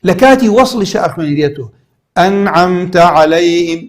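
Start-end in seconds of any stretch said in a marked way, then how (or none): 2.2-3.8 slow, level recited ئەنعەمتە, its n fully pronounced; clear nun in continuation. Shown as a demonstration. Teacher showing students the nun when joined, ئەنعەمتە recited clearly. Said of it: "نونەکە لە کاتی وەصلە خوێندمەوە".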